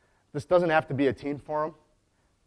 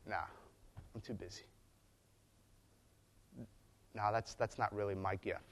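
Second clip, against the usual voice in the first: dismissive brush-off; 4 semitones lower, 12 dB quieter than usual. Retold or imitated. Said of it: "when it comes time to".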